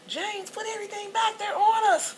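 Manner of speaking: high, loud call across the store; yelling out. Retold or imitated. Girlfriend yelling to James in Walmart, high and loud. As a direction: yell his name across Walmart. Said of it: "she yelled out to him".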